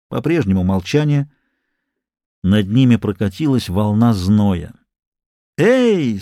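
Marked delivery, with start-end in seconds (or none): 5.6-6.2 high taunting yell; mocking shout. A narrator's reading. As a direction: jeer it up at them. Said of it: "с издёвкой кричал Сим".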